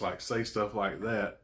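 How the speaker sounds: usual speaking voice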